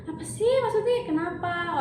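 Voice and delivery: high, exasperated complaint; retold irritated outburst at boyfriend; annoyed. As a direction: demand an answer, irritated. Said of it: "aku kesel".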